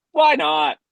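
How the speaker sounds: high, loud quoted "sure"; mock-breezy burst; breezy agreement. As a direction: toss it out high and loud, imitating easygoing bosses. Retells producers' breezy agreement.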